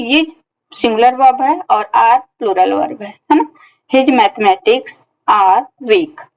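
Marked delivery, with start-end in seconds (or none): 3.9-6.2 slow dictation. Shown as a demonstration. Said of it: "teacher reads example sentence".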